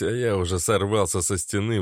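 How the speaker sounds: ordinary delivery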